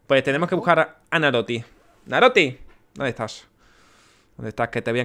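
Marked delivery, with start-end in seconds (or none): none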